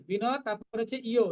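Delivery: ordinary talk, nothing marked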